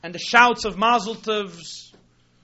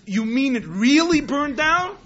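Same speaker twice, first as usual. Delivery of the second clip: higher, rising incredulous question; growing worry; 5 semitones higher, 4 dB louder than usual. Retold or imitated. Miser doubting his own manager, worried disbelief. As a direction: press for confirmation, acting alarmed. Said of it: "the tinge of worry grows".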